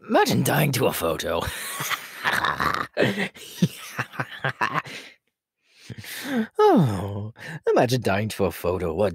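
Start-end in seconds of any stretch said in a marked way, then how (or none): 1.5-5.1 long, breathy clown laugh; wheezy, gleeful clown laughter; creepy cackle. Acted. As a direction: cackle at your own dark joke. Clown amused by deadly photo.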